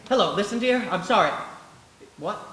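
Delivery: ordinary talk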